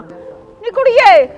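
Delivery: high, loud, slow drawn-out call; long call out. Acted. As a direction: holler her name across the yard. Calling the girl from afar.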